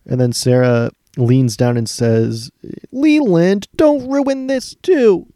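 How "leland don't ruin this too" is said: high, drawn-out mock wail; mock anguished scolding. Acting a wife pleading with her husband at the funeral, high mock wail. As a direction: scold in a sustained, hammed-up high cry.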